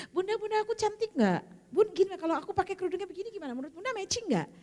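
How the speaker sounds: put-on high, eager girlish voice; daughter asking mother. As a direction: imitate a daughter fishing for a compliment, high-pitched. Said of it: "misalnya kalau Bunda punya anak perempuan".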